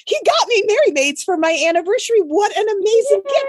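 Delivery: high, gushing delight; thrilled. Retold or imitated. Imagining her anniversary gift, high gushing delight. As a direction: gush over a gift you think you got. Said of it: "And I'm like".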